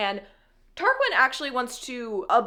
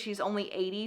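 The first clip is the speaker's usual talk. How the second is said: ordinary talk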